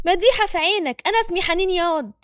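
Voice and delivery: irritated woman correcting a man; angry retort. A narrator's reading. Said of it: "وبتتعصب حنين". (رد غاضب بصوت أعلى)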